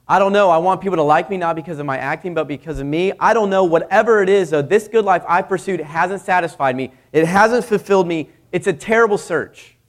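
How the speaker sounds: emphatic confession. Retold or imitated. Jim Carrey admits his search failed, loud and urgent. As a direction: push it out with rising urgency. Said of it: "Jim Carrey would say".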